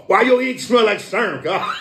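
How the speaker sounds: laughing out the question, high and bursting. Real, not acted. Joking to a friend about ink smell, laughing. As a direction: crack up while asking it.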